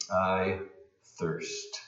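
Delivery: breathy, nearly voiceless I thirst; near-whisper, dry throat. Shown as a demonstration. Preacher voicing Jesus, a parched near-whisper. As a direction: let thirst fade to a breath. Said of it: "almost whisper".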